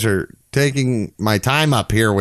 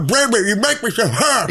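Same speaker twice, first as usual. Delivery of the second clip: silly high character voice; 12 semitones higher than usual. Pretend.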